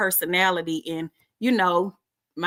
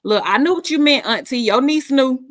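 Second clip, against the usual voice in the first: high, loud and laughing; 6 semitones higher, 6 dB louder than usual. Real, not acted. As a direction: tease her through a laugh. Teasing auntie over typo, laughing.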